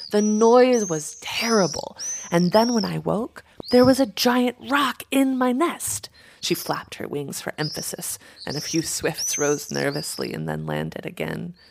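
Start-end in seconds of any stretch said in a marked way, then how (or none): 0.1-6.1 higher, louder dramatic telling; agitated crow. A narrator's reading. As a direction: build the alarm as you tell it. Crow dramatically tells her dream.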